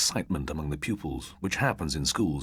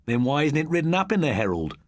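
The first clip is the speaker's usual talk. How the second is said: demanding; 11 semitones higher, 5 dB louder than usual. A narrator's reading. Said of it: "demanded Jeff".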